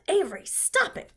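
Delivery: mother crying out at her clowning son; cried out. A narrator's reading. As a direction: call out sharply to the boy. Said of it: "cried his mother".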